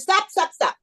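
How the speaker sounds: high, quick repeated interruption; cutting someone off. Acted. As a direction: halt the complaint with rapid insistence. Rabbi cuts off the complaint.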